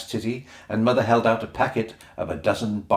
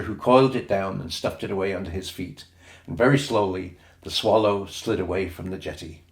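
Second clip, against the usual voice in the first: ordinary delivery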